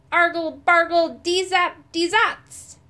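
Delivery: loud chanted magic words; casting a spell. A narrator's reading. Moon Gobble cries his spell at the stone, loud chant. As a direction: cry the spell out like a wizard. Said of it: "raised his wand and cried".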